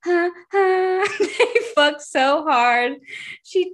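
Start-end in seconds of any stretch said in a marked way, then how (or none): 0.0-1.1 high fake moans; mock climax panting. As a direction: let out two high, exaggerated joke gasps. Repeats the mock climax noises.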